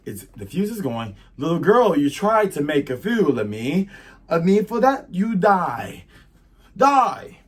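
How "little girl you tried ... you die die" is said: loud, high theatrical menace; villainous threat. Acted. Pirate villain threatens the girl who fooled him, theatrical fury. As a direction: build to a high, loud death sentence.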